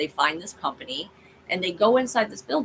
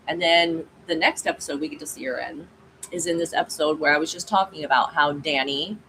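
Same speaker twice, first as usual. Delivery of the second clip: usual speaking voice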